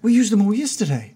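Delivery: high-pitched flustered realisation; dismayed Todd character. Acted. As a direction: catch yourself mid-gush, dismayed. Todd realises fireworks are gone.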